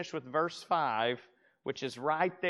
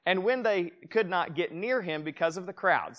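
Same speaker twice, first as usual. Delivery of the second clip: raised, measured Bible reading; scripture reading; 3 semitones higher, 4 dB louder than usual. Acted. Pastor reading the verse to his congregation, raised and measured. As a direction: read the verse out to the room.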